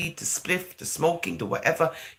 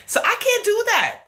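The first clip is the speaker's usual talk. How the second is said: much higher and louder, a put-on squeak; squeaky mock voice; 6 dB louder than usual. Vlogger mocking her pretentious persona, squeaky high. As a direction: squeak it out like a fake sweet girl.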